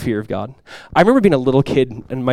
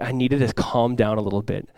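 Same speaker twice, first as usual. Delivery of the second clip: calming down; 4 semitones lower, 9 dB quieter than usual. Retold or imitated. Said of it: "biblical anger".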